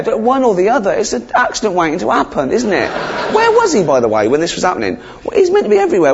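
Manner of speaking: high-pitched, incredulous comic complaint; exasperated rant. Acted. Comic questioning why God put the snake there, exasperated high rant. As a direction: squeak with mounting disbelief.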